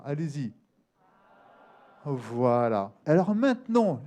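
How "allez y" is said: normal talking voice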